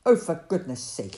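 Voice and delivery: loud, exasperated grumble; grumbled. Acted. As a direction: huff it out in annoyance. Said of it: "he grumbled".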